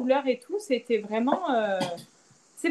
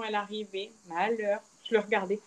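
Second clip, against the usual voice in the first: ordinary talk, nothing marked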